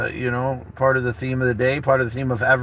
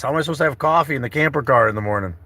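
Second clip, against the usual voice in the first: ordinary talk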